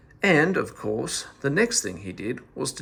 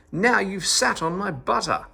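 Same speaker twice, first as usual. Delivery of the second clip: higher angry yell on Now; 6 semitones higher than usual. A narrator's reading. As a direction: scold him with a cry on Now. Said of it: "yelled at him all the more".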